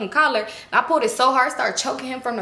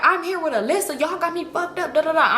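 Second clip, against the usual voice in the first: retold rant, higher-pitched; going off; 6 semitones higher than usual. Woman going off on her man, retold rant. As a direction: rattle off a mild reenacted tirade. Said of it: "I'm just going off on him".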